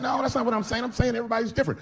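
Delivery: high-pitched mock protest; acted backpedaling. Comedian placating his offended friend, acted backpedaling.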